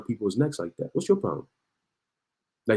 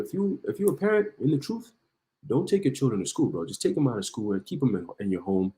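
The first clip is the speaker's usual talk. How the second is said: normal talking voice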